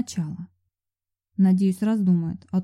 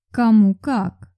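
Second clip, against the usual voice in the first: sly hint; 5 dB louder, much slower than usual. Acted. Vampire hints slyly.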